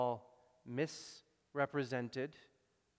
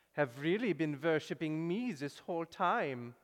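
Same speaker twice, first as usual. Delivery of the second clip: a light vague foreign accent, imitating Horus; mock foreign accent for a god in a video; put-on foreign accent; 3 semitones higher than usual. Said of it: "he says it in this kind of an accent".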